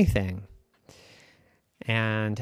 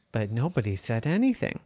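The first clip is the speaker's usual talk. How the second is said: plain reading voice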